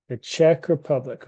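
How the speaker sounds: Czech said with a clear CH; teacher's model pronunciation; careful model. Shown as a demonstration. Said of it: "it sounds just like this".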